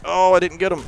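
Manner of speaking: high, loud cry of dismay; frustrated outburst. Real, not acted. Gamer sees the play slip away, dismayed. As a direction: let the missed play sting.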